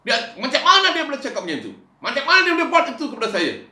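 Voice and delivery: loud, high fiery sermon; preaching at full force. Acted. Pastor exhorting the congregation, loud and high. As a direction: pound it home to the church, full voice.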